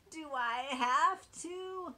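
high, slow, loud whine of a piglet; whiny piglet. Acted. Little Oink protesting to his parents, high slow whine. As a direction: drag it out like a sulky little pig. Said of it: "Little Pig snorted".

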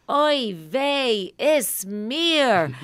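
put-on high, loud, clumsy Yiddish accent; bad Yiddish accent. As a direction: overdo a terrible Yiddish accent, shrill. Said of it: "Diane Keaton's Yiddish accent is terrible".

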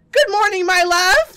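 loud, high sing-song greeting; hammed-up husband. Husband greeting his green-eyed wife, loud and high. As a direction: greet her like a cheery sitcom husband.

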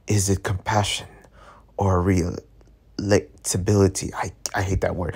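inner thought. Acted. Reading inner thought, low.

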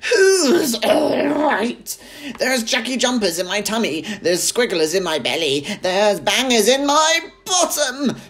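high, loud wailing rant; frantic complaint. A narrator's reading. Grandma wailing about her tummy. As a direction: wail about her insides.

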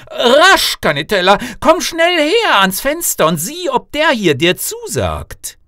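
loud, high urgent call; father calling his daughter over, loud and high; excited calling out. Acted. King calls daughter to window.

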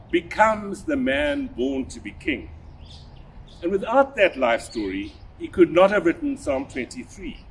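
ordinary talk, nothing marked

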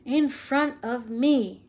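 each word a thunderclap; thundering. A narrator's reading. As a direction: giant defending his headmaster, roaring. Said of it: "he thundered".

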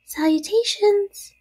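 tiny, very high spider voice; small voice. A narrator's reading. Baby spider greets Wilbur, small voice. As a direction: greet in a high little squeak. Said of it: "he heard a small voice".

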